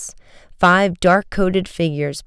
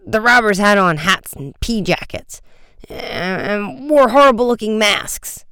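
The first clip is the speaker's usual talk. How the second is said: higher-pitched, flustered witness; highly excited; 6 semitones higher than usual. A narrator's reading. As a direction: stammer it out in agitation. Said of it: "highly excited".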